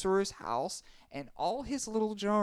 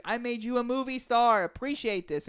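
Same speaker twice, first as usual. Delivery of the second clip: angry; 8 semitones higher, 3 dB louder than usual. Acted. Sorcerer shouts at Grandpa.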